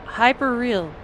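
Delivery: high, loud chirpy AI voice; bright assistant read; virtual assistant voice. Acted. Virtual Kara repeats password.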